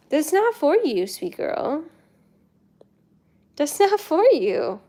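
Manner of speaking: pet talk. Real, not acted. Scolding a pet tenderly.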